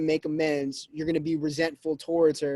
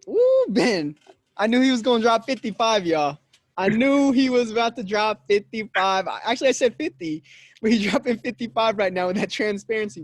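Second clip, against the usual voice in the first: hyped praise; 5 semitones higher, 5 dB louder than usual. Real, not acted. Host hyping guest's honesty.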